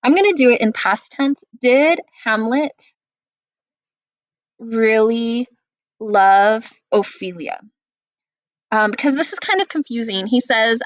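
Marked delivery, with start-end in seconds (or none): 1.6-7.6 slow, spaced out, word by word with long gaps; teacher to class, dictating the question for notes, slowly; slow dictation. Shown as a demonstration. Teacher to students while they write it down, slow dictation pace. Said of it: "Let's put that on the left side of our notes".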